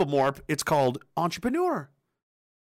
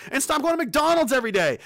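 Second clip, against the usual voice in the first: usual speaking voice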